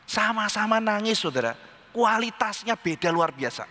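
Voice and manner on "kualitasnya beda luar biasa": ordinary delivery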